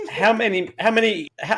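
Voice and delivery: high, eager stumbling question; excited. Real, not acted. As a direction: blurt it out excitedly.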